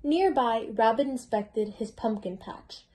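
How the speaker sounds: plain reading voice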